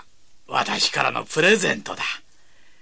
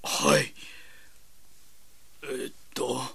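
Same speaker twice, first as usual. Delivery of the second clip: ordinary talk, nothing marked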